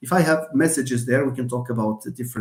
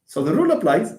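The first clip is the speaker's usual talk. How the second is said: ordinary delivery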